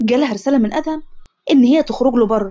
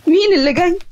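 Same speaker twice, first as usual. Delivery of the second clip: mildly scared, higher-pitched question; understated narrated fear, voice raised in pitch; fearful; 5 semitones higher than usual. A narrator's reading. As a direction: ask it with a touch of fear. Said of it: "عائشة بخوف".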